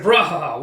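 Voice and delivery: loud belly laugh, rahaha; hearty booming laugh. Acted. Kuroku bursts in laughing loudly with his news. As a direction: laugh it out big and proud.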